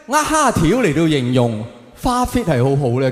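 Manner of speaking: ordinary talk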